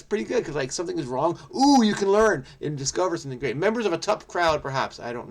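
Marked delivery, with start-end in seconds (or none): none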